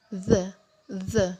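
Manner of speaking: "the" with tongue tip on the upper teeth; voiced TH sound. Shown as a demonstration. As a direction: say "the" with a buzzing th. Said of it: "ujung lidah ditaruh di gigi atas". (bunyi "the" bergetar, ujung lidah di gigi atas)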